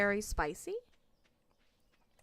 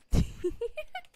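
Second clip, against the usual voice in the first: high giggle; 14 semitones higher than usual. Real, not acted. Laughs after teasing the creature.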